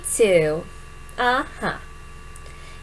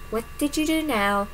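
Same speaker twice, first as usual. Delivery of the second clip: ordinary talk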